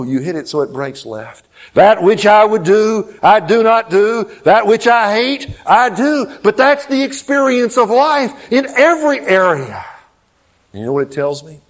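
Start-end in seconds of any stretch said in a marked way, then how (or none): 1.7-9.8 high, loud preaching climax; full-force preaching. Acted. Pastor preaching to his congregation, full force. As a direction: preach it out to the back row.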